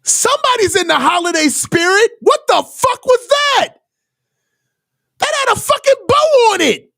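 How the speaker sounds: high-pitched outraged yelling at the screen. Real, not acted. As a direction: yell at the TV in disbelief at the throw. Fan raging at interception on TV.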